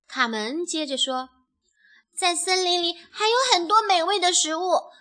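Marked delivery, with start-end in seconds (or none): 2.1-4.9 lightly voiced, higher little chick; young chick persuading. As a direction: coax like an earnest little chick.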